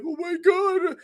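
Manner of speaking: high mocking whine; whiny fan. Mocking fans who complain about everything, high whine. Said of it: "stop bitching and moaning and being like".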